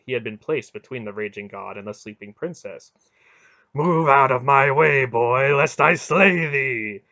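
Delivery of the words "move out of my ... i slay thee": raised, booming warning; menacing, angry order; loud threat. A narrator's reading. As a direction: threaten at full voice, ending slowly. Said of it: "Mara threatened".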